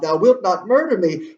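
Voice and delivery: high, loud cry of alarm. Acted. Gertrude to Hamlet as he threatens her, crying out. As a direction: raise the voice in acted protest. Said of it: "she cries out".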